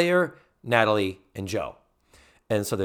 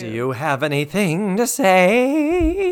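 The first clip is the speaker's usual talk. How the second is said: slow, higher, louder stern housemother; Mrs Garrett impression; 8 semitones higher, 6 dB louder, slower than usual. Finishing the requested Mrs Garrett bit, demanding an apology. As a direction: drag it out like a scolding mother waiting for an apology. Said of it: "do the act, do the voice".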